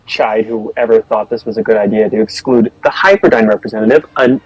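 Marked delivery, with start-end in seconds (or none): none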